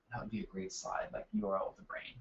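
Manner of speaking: very soft, low aside to himself; muttered aside. Real, not acted. Webinar host noting a slide idea to himself, muttered very softly. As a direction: murmur it under your breath, thinking aloud.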